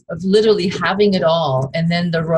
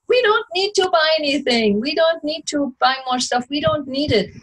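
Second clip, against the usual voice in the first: pitched high, playful insistence on not buying; mock herself lecturing her husband, voice up high; higher playful nagging; insistent mock self; 10 semitones higher than usual. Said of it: "I'm like".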